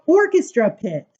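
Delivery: plain reading voice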